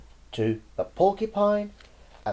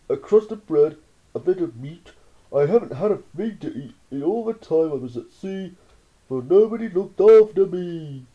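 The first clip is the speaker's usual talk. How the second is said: anteater's plea; 3 semitones higher, 5 dB louder than usual. Acted. Starving anteater begs Roy for food, pleading. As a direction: beg for food in a put-on beast voice. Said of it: "softly said".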